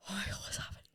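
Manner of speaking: breathless whispered inner panic. Retold or imitated. Fiancée whispering to herself as he proposes. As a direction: whisper it to yourself in shock.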